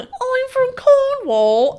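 hammed-up Cornish accent, high, loud and drawn out; mock Cornish accent. Host teasing she'll read the listener's mail in his accent, mock Cornish. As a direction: do an exaggerated, joking Cornwall accent. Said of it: "should I read this in an offensive accent?".

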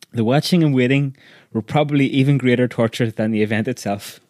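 ordinary delivery

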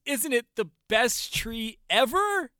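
boy boasts about his tree, in a high, slow child imitation; proud little boy. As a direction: stretch it like a thrilled kid. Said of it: "And he goes".

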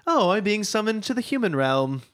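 posh British accent, high and put on; hammy upper-class English gent; bad English accent. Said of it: "that's enough of the bad English accent".

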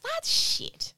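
high squeaky mock indignation; mock squeal. Voicing her reaction.

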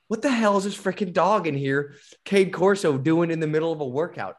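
high, incredulous retelling; retold disbelief. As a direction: act out baffled outrage at an absurd sight. Retells his shock at the dog.

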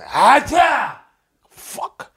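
high explosive sneeze, then an unvoiced curse; loud mock sneeze. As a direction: imitate a bone-shaking sneeze. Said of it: "I'm talking real ruckus".

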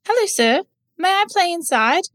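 put-on very high, eager child voice; polite little boy. A narrator's reading. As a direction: ask sweetly to be let in.

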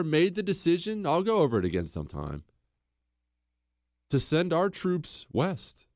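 ordinary delivery

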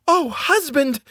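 very high, alarmed wife's cry; terrified wife, pitched right up. A narrator's reading. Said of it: "said the woman, terrified".

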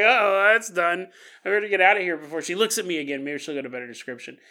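hurried, worried inner voice; rushed getaway thoughts; quick nervous mutter. Retold or imitated. Hopping man's imagined thoughts.

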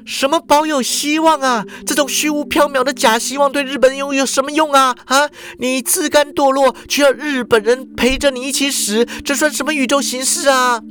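put-on high scolding girl's voice; high, furious rebuke, stress on 算; angry. A narrator's reading. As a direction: tear into him. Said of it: "他就发怒了".

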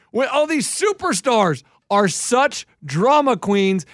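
ordinary delivery

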